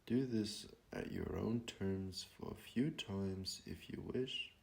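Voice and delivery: slow, hushed meditation voice with long pauses; guided meditation. Real, not acted. Teacher guiding listeners to breathe and be present, calm meditation voice. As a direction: ease them gently into stillness, phrase by unhurried phrase.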